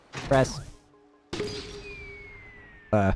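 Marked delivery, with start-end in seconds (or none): none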